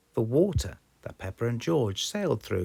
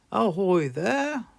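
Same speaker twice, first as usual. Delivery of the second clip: slow, loud hearty sailor call; Grandpa Pig voice; 12 semitones higher, 7 dB louder, slower than usual. Acted. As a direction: hail them from the boat. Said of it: "Grandpa Pig said".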